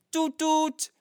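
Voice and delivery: high, slow toot toot like a car horn; horn imitation. A car arrives honking on the sand, playful toot toot.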